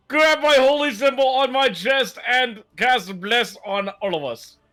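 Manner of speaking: high, slow wail through the action; acted screaming. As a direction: announce your move in a strained high cry. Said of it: "as I'm screaming it out".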